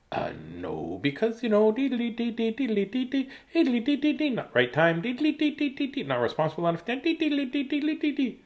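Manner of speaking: mock a parent's blah-blah excuses; mock dismissive parent. Parent brushing off child's puppy request, mocking deedly-dee babble. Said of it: "It's more like".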